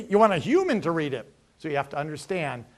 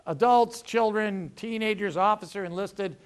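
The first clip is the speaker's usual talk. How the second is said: usual speaking voice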